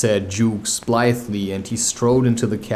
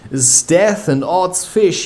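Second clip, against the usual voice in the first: thundering; 6 semitones higher, slower than usual. Shown as a demonstration. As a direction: thunder 'Sdeath and odds fish like the captain. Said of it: "he thundered".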